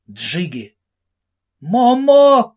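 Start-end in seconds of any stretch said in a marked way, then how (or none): 1.6-2.5 a loud, glad shout of her name; joyful shout. A narrator's reading. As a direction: call out to her across the street with joy. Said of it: "крикнул он".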